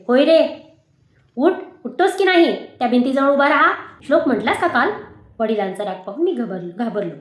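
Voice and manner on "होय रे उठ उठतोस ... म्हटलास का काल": louder, higher furious scolding; a father shouting at his lying son; angry father. Acted. Father scolding Shyam for lying, angry raised voice. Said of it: "रागाने म्हटले".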